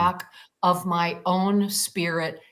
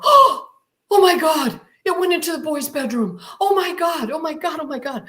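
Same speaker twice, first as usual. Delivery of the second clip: panicked thoughts; 8 semitones higher, faster than usual. Retold or imitated. Mother panicking that the kids will see, high and rushed. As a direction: blurt out the fear after a gasp.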